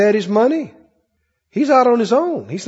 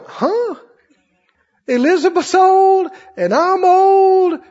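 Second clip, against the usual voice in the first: high, slow disbelieving huh; mock astonished old man; incredulous Zacharias; 9 semitones higher, much slower than usual. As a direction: play the old priest who can't believe it.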